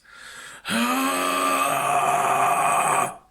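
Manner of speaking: airy rasp through closed throat; loud rasping exhale; compressed breathy rasp. Shown as a demonstration. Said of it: "that is when I bring in the compression".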